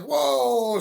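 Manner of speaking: jolted by fifteen radar guns; startled whoa. Retold or imitated. Sees radar guns rise, he gasps out a startled whoa. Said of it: "freaked me out".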